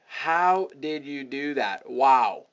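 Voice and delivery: slow, drawn-out amazed imitation; blown away. Client replying to the email, blown away. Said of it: "They're blown away by it".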